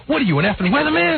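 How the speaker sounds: high-pitched retold exasperation; Soupy exploding. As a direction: imitate a host blowing up at his sidekick. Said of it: "He goes".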